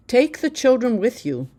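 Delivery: demanding. A narrator's reading. Husband insists she take the children, demanding. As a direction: press it as an order. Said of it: "Modise demanded".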